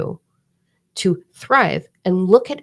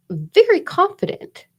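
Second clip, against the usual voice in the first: slow and much higher, emphatic; 13 semitones higher, 4 dB louder, slower than usual. Real, not acted. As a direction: declare it proudly, drawn out. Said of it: "self-assured declaration".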